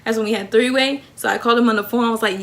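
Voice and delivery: ordinary talk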